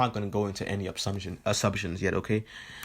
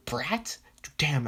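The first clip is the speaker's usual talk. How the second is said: higher, incredulous echo; mock disbelief; 5 semitones higher than usual. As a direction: echo the insult as a startled question. Bakugo echoes the insult in disbelief.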